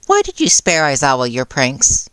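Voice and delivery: a touch of sly interest in the voice; curious. A narrator's reading. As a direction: ask it with gentle curiosity. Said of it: "Nezu asked curiously".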